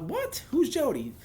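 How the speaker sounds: a very high squeak of confusion on what; flabbergasted, voice jumps way up; baffled outburst. Retold or imitated. Retold confused reaction.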